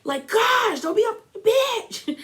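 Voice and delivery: high, mocking shrill outburst of Tamra; mock freak-out. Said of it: "Tamra freaks out and was like".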